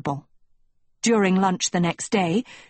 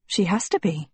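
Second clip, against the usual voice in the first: plain reading voice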